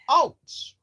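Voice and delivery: sudden loud high ouch; shout of pain. A narrator's reading. Dad shouting as door hits his ankle. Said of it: "shouted Mister Dent".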